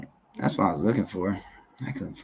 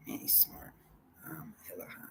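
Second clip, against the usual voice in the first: whisper; 20 dB quieter than usual. Real, not acted. Whispered aside.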